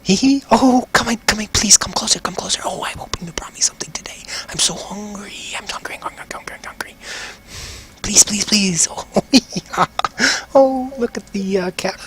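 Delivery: high, eager creepy voice that drifts into a whisper; hungry voice in his head. Acted. Voice in the rogue's head begging him for food. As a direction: coax him inward like a starving thing.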